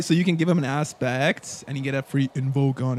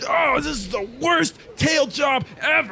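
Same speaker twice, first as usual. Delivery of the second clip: much higher, shouted cry of a busted tail man; caught sneak; 9 semitones higher, slower than usual. Acted. The busted tail guy wails at the party, high frustrated shout. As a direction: howl in frustration at being caught, slow on the last line. NPC stomping on his hat.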